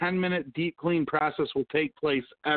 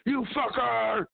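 drawn-out, higher mock yell, slow; 7 semitones higher, slower than usual. Host yelling at drivers through the window, a slow high mock yell. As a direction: stretch out an insult through the car window, pitched up. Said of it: "I fucking yell at people through the window".

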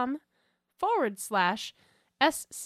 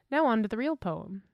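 usual speaking voice

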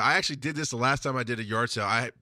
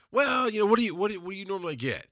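a hint of Jim, higher and coy; client deflecting; 10 semitones higher, faster than usual. Client Jim turns the price question back, higher and coy.

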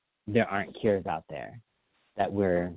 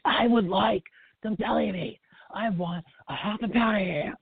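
high-pitched mock yelling at the deli counter; hammed-up screaming politician; 12 semitones higher than usual. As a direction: bellow a simple order, imitating a constant yeller. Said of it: "he screams constantly".

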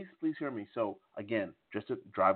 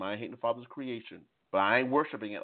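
ordinary delivery

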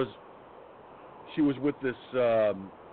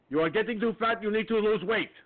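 pitched-up, lecturing mock doctor voice; imitating the foreign doctor; 9 semitones higher, 3 dB louder than usual. Patient mocks his doctor's scolding in a high imitation voice. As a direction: mimic her scolding him about his weight, voice up high. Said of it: "Oriental lady".

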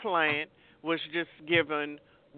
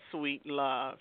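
usual speaking voice

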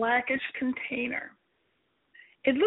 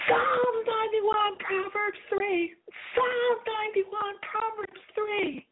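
high, slow desperate cry; 9 semitones higher, slower than usual. Retold or imitated. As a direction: call the verses out, slow and high. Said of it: "I cried out".

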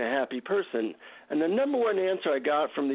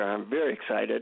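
normal talking voice

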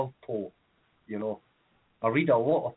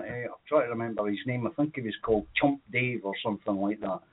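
ordinary delivery

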